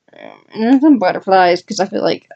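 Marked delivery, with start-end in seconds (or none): none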